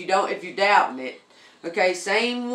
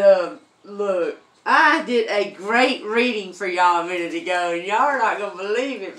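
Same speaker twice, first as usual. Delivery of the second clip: excited; 6 dB louder than usual. Real, not acted. Excited about a lost reading.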